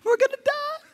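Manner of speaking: high, panicky cry of terror; mock terror. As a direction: squeal it like you're doomed. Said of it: "they began to cry out in terror".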